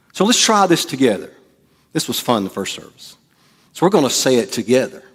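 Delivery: usual speaking voice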